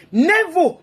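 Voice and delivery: loud high NEVER; emphatic shout. Real, not acted. Preacher warning women, shouted loud. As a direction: hit it hard and high. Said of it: "preaching emphatic never".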